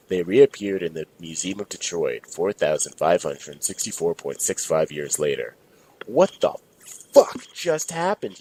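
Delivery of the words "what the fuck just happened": high, startled yell. Acted. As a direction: shout it in confusion. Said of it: "yelled Darnell".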